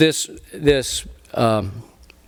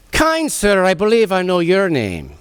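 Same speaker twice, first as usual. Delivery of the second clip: put-on Irish accent meant for a Scotsman, pitched up at first; comic attempt at a lilting Irish voice; 3 semitones higher than usual. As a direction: greet him in a stagey Irish brogue. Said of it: "I'm doing the Irish. He's Scottish".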